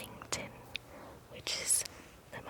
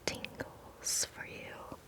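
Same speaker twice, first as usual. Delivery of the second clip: usual speaking voice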